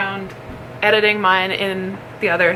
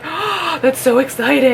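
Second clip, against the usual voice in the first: higher-pitched, gasping mock thrill; retold excitement; 5 semitones higher than usual. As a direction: replay your excited reaction. Retold own reaction to parcel.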